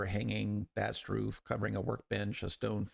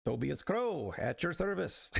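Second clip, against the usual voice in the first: higher, lilting attempt at an Irish smith; attempted Irish accent; 7 semitones higher than usual. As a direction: introduce yourself in a rough Irish brogue. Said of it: "Irish accent would probably be".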